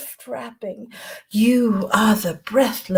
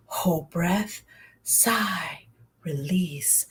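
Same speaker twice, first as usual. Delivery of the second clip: ordinary talk, nothing marked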